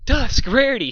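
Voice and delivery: high, loud startled shriek. Acted. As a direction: shriek both names in alarm. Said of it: "she shrieked".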